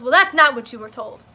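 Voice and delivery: loud, dismissive clerk voice; mock snippy clerk. Imitating the clerk correcting her, loud and dismissive. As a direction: mimic her talking down, loud. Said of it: "And she goes".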